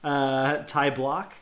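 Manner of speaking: ordinary delivery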